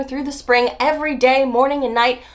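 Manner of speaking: normal talking voice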